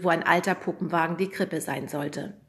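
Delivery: ordinary delivery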